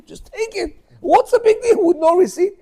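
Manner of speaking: high, loud, incredulous; exasperated. Retold or imitated. Husband on the phone telling wife to just take the money, high and exasperated. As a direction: squeak it out in disbelief.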